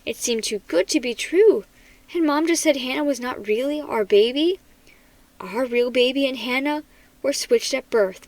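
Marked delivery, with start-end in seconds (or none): none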